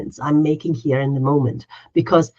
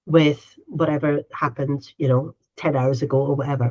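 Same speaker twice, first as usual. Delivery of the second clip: slow calm naming; 3 semitones lower, slower than usual. Shown as a demonstration. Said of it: "just taking a minute and say".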